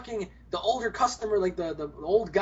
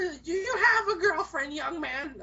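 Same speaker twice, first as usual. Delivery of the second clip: put-on high laughing old-lady voice; flirty granny; 7 semitones higher than usual. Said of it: "This one granny's hitting on me".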